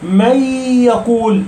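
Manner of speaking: "من يقول" with the n melted into a long nasal y; noun merged away. Shown as a demonstration. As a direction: drop the n of "من" into a held y of "يقول". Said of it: "ولما تضغم النون".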